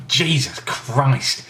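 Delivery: slow, stunned real shock. Real, not acted. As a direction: caught off guard by the sourness. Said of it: "I'd reacted by saying, "Jesus Christ," because, just 'cause I was surprised".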